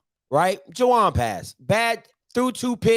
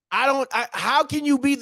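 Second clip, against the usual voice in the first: normal talking voice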